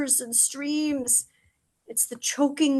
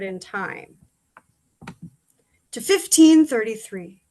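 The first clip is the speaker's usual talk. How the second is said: radio host announcement; 2 semitones lower, 5 dB louder than usual. Real, not acted.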